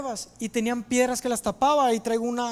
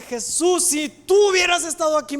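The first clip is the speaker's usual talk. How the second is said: higher, forceful reproach; 5 semitones higher, 5 dB louder than usual. Acted. María meets Jesus after her brother's death, acted reproach. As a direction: voice the complaint, pushing it higher and louder. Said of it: "Entonces sí era reclamo".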